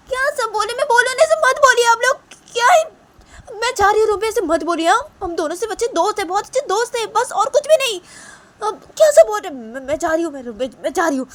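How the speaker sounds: very high, flustered protest; तिलमिलाई बेटी. Acted. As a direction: storm off protesting in a high-pitched voice. (ऊँची आवाज़ में झल्लाकर जाती बेटी)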